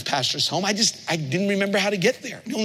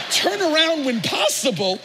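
excited echo; 9 semitones higher than usual. Retold or imitated. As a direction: repeat it thrilled and laughing. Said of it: "I said".

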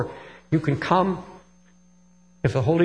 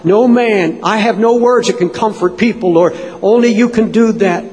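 raised, pleading prayer voice; fervent prayer; 5 semitones higher, 8 dB louder than usual. Real, not acted. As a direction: plead urgently with the Lord, pitched high. Impassioned prayer for comfort.

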